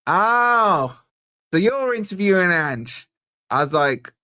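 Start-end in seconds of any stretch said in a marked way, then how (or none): 0.1-3.1 put-on Australian accent, pitched up a touch; hamming up an Aussie TV producer's greeting; mock Aussie caller. Retelling an Australian caller's phone greeting, mock Aussie accent.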